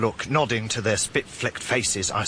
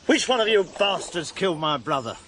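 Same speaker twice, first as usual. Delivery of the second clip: high-pitched furious demand; raised, accusing voice; angry confrontation; 8 semitones higher than usual. Acted. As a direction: demand an answer from the killers. Confronting his brother's killers.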